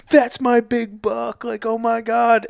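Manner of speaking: excited dad. Retold or imitated. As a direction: act out the proud father losing it. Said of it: "her dad goes".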